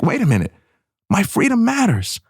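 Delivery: high, fast, indignant mom voice. Retold or imitated. Mom insisting on her own freedom, high and quick. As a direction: rattle it off like a mom defending her turf. Voicing the mom's upset.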